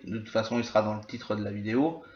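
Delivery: normal talking voice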